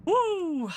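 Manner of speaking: whooping in celebration; high victory whoop. Real, not acted. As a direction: let out a high woo. Celebrating the win.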